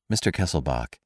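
ordinary delivery, nothing marked

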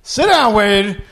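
hammed-up Pacino coach. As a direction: bark at Wade from the sideline. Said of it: "Coach Pacino".

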